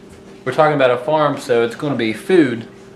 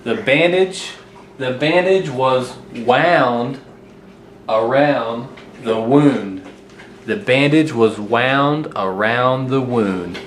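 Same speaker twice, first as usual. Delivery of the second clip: slow careful reading; slower than usual. Shown as a demonstration. Teacher reading a tricky sentence to viewers, slow and deliberate. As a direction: read the sentence slowly, word by word.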